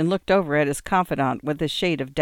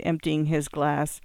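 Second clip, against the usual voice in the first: ordinary delivery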